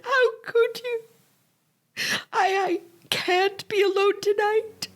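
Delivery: high, crying pleas; weeping, begging the absent husband; sobbing despair. Acted. Wife breaks down over husband.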